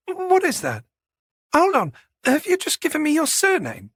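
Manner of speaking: high-pitched, incredulous questioning; puzzled, frowning. A narrator's reading. Manager realising he gave only his surname, puzzled. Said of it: "She frowned".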